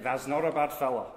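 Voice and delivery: laughing broad Yorkshire; Yorkshire accent. Acted. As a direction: chuckle through it in Yorkshire. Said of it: "In a Yorkshire accent".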